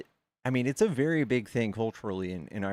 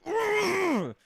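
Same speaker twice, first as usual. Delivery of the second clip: very high, louder disgusted "Ugh!"; outraged groan; 5 dB louder than usual. Retold or imitated. Fuming rival watches the insult tape, very high outraged ugh.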